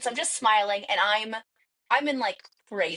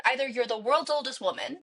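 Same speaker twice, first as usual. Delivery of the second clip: ordinary talk, nothing marked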